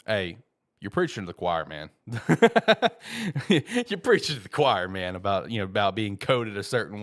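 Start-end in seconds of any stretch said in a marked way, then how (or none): 1.9-3.4 big loud burst of laughter; a real belly laugh at a friend's joke; hearty laugh. Real, not acted. Laughing at shared misery. 3.5-4.9 laughing. Real, not acted. Repeats line while laughing.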